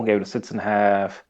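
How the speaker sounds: ordinary talk, nothing marked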